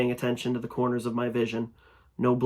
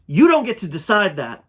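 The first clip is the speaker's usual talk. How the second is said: loud, higher angry defiance; defiant shout; 8 semitones higher, 9 dB louder than usual. Acted. Narrator shouting defiance at the creature. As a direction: throw it back at the creature.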